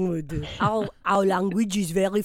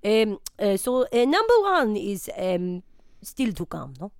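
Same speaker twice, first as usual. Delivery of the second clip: lightly hammed French accent; a comic Frenchman being interviewed. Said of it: "Pierre Jambon".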